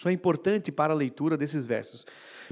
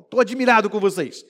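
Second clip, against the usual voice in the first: mais alto, indignado e espantado; tom de Paulo indignado; 5 semitones higher, 7 dB louder than usual. Acted. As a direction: repreende como pai desapontado. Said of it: "o tom da voz de Paulo". (Paulo indignado com os gálatas)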